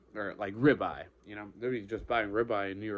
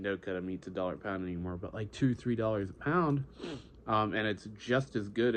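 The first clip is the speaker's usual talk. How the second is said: usual speaking voice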